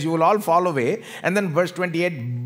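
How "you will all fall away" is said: ordinary talk, nothing marked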